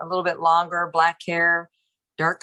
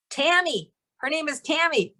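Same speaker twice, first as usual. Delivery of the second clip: mock yell; 7 semitones higher than usual. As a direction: imitate calling out a name, voice raised in pitch only. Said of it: "And I yelled to her".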